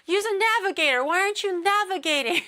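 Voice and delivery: high, quick, exasperated pestering; mock an exasperated local, pitch jumping up; frustrated local. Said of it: "there's nothing more frustrating".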